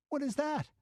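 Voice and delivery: put-on sudden alarm, high girl's voice; tense girl. Girl at the window hears something, sudden fear. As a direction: freeze at a strange noise.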